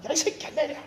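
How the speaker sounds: high but held-back mock yell; repeated yell. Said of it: "이랬다".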